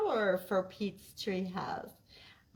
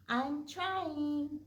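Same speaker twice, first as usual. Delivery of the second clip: higher little bird puppet voice; 8 semitones higher than usual. Acted. Puppet bird Flint answers.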